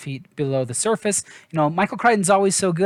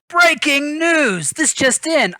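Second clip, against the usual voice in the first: much higher, slow, hammed-up newscaster voice; over-the-top pretend anchor bursting in; mock news flash; 10 semitones higher, 4 dB louder, much slower than usual. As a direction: announce it like a joke breaking-news anchor.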